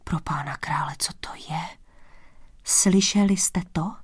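polohlasný udivený šepot dvořanů; zašeptej to jako užaslý dvořan; šeptem. A narrator's reading. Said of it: "šeptali dvořané". (šepot dvořanů)

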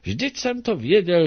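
little sprite's gleeful shout; joyful exclamation. Acted. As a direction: call it out happily, as if you always knew. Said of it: "a vykřikl".

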